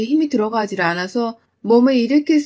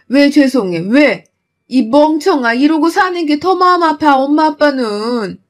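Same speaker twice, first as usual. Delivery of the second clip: louder, higher exasperated rebuke; mother yelling at her daughter that staying hurts them more, louder; furious loving scolding; 3 semitones higher, 4 dB louder than usual. Acted. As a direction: scold out of hurt love.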